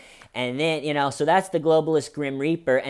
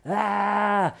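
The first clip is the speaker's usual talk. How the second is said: higher mock scream; mock shaman scream; 7 semitones higher than usual. Imitating the shaman screaming in the Senate, higher mock scream. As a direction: yell it like the shaman on the Senate floor. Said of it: "he's in there screaming".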